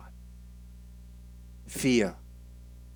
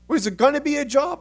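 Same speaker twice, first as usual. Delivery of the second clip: pressing mom; 11 semitones higher, faster than usual. Retold or imitated.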